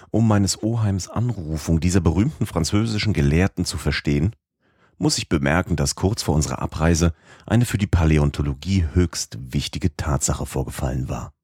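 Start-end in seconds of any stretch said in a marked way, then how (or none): none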